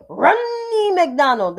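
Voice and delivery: Romney McDonald slowed, long n in Romney; how she said it. Retold or imitated. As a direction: stretch Romney McDonald slow and deliberate. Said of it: "that's how she said her name".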